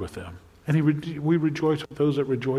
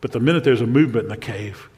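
ordinary talk, nothing marked

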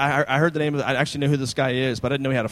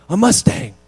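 put-on kid's eager guess, higher and louder; excited kid guess; 5 semitones higher, 8 dB louder than usual. Son guessing the car at the church, high and loud. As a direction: blurt it like an excited little boy. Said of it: "And Landon's like".

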